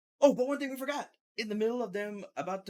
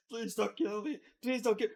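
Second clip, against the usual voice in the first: begging for my life; 6 semitones higher than usual. Retold or imitated. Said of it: "he was even fucking begging for his life".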